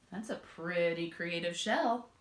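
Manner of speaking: ordinary delivery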